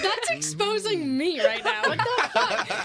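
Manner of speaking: high, loud, laughing outcry; laughing in mock outrage at the roast; laughing protest. Real, not acted. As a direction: cry out through laughter at being exposed. Laughing, protesting being exposed.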